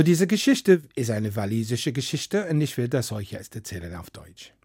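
ordinary delivery

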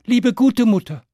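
put-on high-pitched coaxing child; butter mother up like a child; pleading third child. A narrator's reading. Third child flattering mother for pancake, high pleading. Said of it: "Das dritte Kind sagte".

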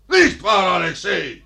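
loud nudge on nicht. Acted. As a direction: repeat it, demanding an answer.

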